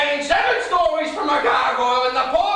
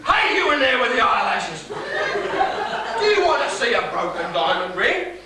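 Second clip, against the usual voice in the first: sarcastic shouting; 5 semitones higher than usual. Acted. Father yelling sarcastically at his daughter through the door. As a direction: yell mockingly at the locked door.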